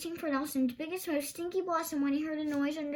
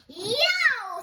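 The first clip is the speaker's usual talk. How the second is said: high loud yelp of shock; screech; 10 semitones higher, 9 dB louder than usual. Acted. Thorndyke yelping when swamp water is poured on him, loud. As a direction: yelp as hot water hits. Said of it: "Thorndyke screeched".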